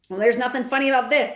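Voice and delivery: put-on brisk, firm old lady, fast; a hint of a stern grandmother. A narrator's reading. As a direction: cut in quickly and firmly. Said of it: "said my grandmother".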